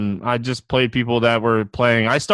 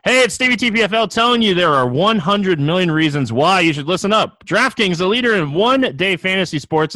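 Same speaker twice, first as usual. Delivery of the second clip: upbeat ad read, pitched up; booming prize announcer; 4 semitones higher than usual. Acted. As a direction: sell it like a radio spot. Reads a sponsor ad.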